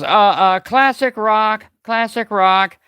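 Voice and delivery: mock yelling for classic rock, high and loud; a hammed-up plea, slow on classic rock; mock protest. [name] protesting the belly dancing music, mock yelling.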